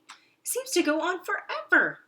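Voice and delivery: high, loud wonder; amazed. Acted. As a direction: marvel at the size. Awed at big house.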